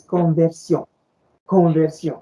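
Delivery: "conversion" with a French r and nasal -on ending; French pronunciation. Shown as a demonstration. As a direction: say "conversion" as the French word, con-vair-syon. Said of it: "the word conversion in French".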